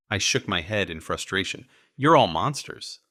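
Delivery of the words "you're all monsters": frustrated outburst. Acted.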